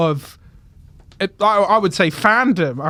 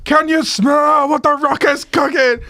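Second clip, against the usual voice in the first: The Rock catchphrase; 8 semitones higher than usual. Retold or imitated. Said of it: "and then it's just like".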